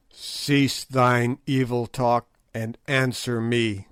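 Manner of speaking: usual speaking voice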